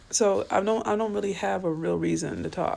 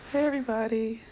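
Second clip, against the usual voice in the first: ordinary talk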